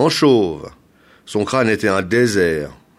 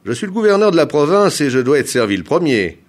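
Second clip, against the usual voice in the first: loud, raised, commanding voice; imperious; 6 semitones higher, 7 dB louder, faster than usual. A narrator's reading. Governor demanding to be served first, imperious. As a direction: pull rank forcefully. Governor pulls rank on traveller.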